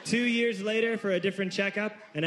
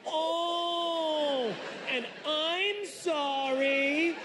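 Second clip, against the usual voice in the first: high, slow, stretched "Oh, and I'm sorry"; yelled apology; 10 semitones higher, much slower than usual. Retold or imitated. Recounting what the doctor heard, a high drawn-out yell. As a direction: drag the apology out in a high wail. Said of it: "he's had to deal with".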